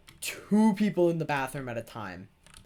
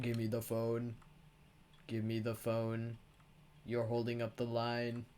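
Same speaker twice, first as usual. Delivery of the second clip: deep intimidating; 3 semitones lower, 6 dB quieter than usual. Retold or imitated. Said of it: "this deep, trying to be intimidating".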